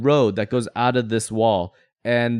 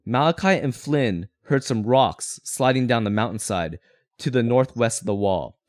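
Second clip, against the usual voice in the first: normal talking voice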